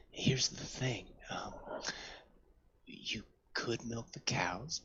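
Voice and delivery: hushed slow whisper. Acted. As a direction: lean in and whisper it. Farmer whispers his warning.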